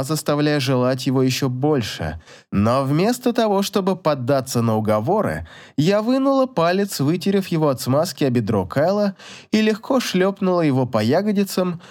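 plain reading voice

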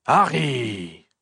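big gruff drawn-out call; gruff exclamation. A narrator's reading. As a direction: call out gruffly, stretching the name. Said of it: "s'exclama une voix bourrue". (exclamation bourrue et traînante)

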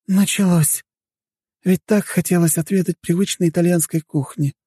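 high-pitched woman's voice, resigned sigh; sighing Flara. A narrator's reading. Woman guest missing her Italian dinner, sighing. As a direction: lament it in a high female voice. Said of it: "вздохнула Флара".

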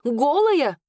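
high, astonished single word; shocked question. A narrator's reading. Kitchen girl asks about the newcomer, high shocked question. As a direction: shoot the pitch up in shock. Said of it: "ахнула одна из девушек".

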